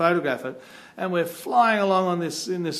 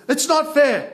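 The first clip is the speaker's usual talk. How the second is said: high, loud mock-childish complaint; upset protest; 10 semitones higher, 7 dB louder, slower than usual. Upset travel companion complaining about being left out, mock protest. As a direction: protest like a sulky kid. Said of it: "he's getting all upset".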